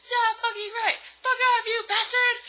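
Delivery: high, loud mock-furious scream; over-the-top outraged shriek. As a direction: yell it at him, hammed up. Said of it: "I screamed".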